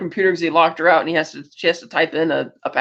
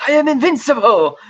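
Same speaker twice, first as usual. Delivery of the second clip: higher, hammed-up gloat; playful mock triumph; triumphant; 6 semitones higher, 3 dB louder than usual. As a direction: quote the line like a smug victor, higher. Quoting famous line.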